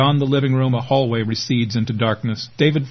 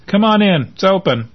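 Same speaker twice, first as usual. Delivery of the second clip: called out loud and high toward the door; shout; 8 semitones higher, 4 dB louder than usual. A narrator's reading. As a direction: shout across the room to the door. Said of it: "David shouts".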